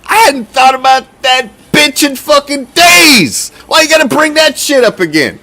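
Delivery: high, annoyed rant at being reminded; fed-up sigh turned into a mock rant; exasperated groan. To a friend bringing up the ex, fed-up griping. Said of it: "And I'm like".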